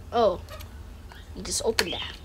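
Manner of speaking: ordinary delivery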